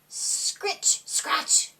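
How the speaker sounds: breathy scritch scratch, no voice; whispered scratching sounds. Acted. Narrator imitating kittens scratching at the door, whispered.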